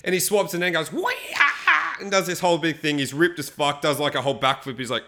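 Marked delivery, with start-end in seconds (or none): 0.9-2.0 high, slow whooping cry; showy whoop. Retold or imitated. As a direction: whoop it out like a show-off. Ripped guy shows off.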